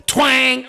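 loud high string-snap twang. Retold or imitated. Describing the third string breaking, a loud mock twang. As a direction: mimic a guitar string breaking.

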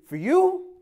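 high, sing-song "for you"; mock seller's squeak on "for you"; hustler's sales voice. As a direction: pitch "for you" way up like a street seller. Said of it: "that's how they say it".

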